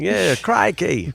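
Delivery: hammed-up mock Australian; Australian accent. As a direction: stretch Crikey out like the Crocodile Hunter. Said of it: "my Australian accent".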